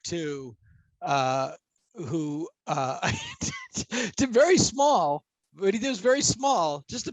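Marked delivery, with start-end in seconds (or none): 3.0-4.1 sudden high-pitched laugh; high laughing squeal. Real, not acted. Laughing burst mid-sentence.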